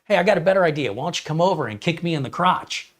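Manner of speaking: pretend-helpful suggestion, dripping sarcasm; sarcastic comeback. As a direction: fake cheer turning into a dig. Sarcastic reply to lowball buyer.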